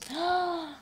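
high, sudden intake of breath; high amazed gasp; delighted gasp. Real, not acted. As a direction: gasp at a happy surprise. Reacts to concealer result.

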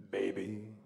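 deep, slow spoken bass croon; low sexy voice. Shown as a demonstration. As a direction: talk it low and sultry like the bass man. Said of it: "he would talk it in a really low and sexy voice".

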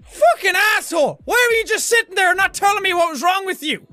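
loud, fast, high-pitched indignant tirade; exasperated outburst at full tilt; out-of-character rant. Acted. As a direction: drop the accent and rant rapidly. Drops the doctor act, ranting.

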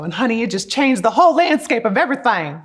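emphatic country-accented exclamation. Real, not acted. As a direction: slip into a big country accent. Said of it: "where that country accent came from".

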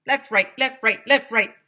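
loud high marching calls; shouted drill. Acted. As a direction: bark it out. Principal drills.

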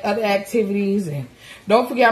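normal talking voice

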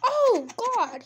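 panicked. Real, not acted. Sudden in-game panic.

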